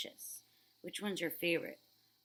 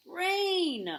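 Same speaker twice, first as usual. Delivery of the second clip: loud, high grumpy cry of rain; upset shout; 12 semitones higher, 9 dB louder than usual. Acted. Old man upset about the rain, loud grumpy cry. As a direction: yell it like a cranky old man. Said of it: "I'm saying it differently because it looks like this guy is upset".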